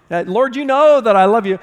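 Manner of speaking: a voiced disciple protesting earnestly; pleading Peter. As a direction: insist on his love, straining upward. Peter protesting his love.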